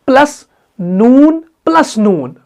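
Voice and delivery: slow, letter-by-letter spelling. Shown as a demonstration. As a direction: stretch each नून slowly. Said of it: "आइन plus दाल".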